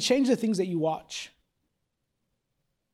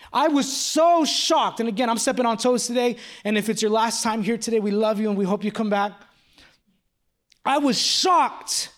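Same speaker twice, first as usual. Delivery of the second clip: preaching at full force; 5 semitones higher, 3 dB louder than usual. Acted. Preaching builds to climax.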